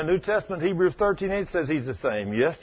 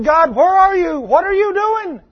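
high, loud desperate cry to God; anguished cry; 13 semitones higher, 9 dB louder than usual. Retold or imitated. Preacher voicing a despairing cry at God. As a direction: cry out as if God has abandoned you.

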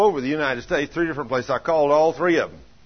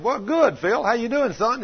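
high, upbeat hello on a call; hearty greeting; 10 semitones higher than usual. Retold or imitated. Acted phone greeting to Philip.

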